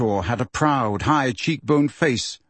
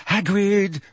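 high, frightened call; terrified; 7 semitones higher than usual. A narrator's reading. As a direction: plead in fear. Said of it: "in a high-pitched and terrified voice".

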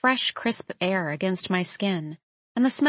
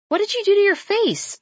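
very high, louder, astonished question; startled blurt; 12 semitones higher, 5 dB louder than usual. A narrator's reading. Woman seeing his beard is gone, startled high blurt. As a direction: blurt it out in shock. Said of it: "I blurt".

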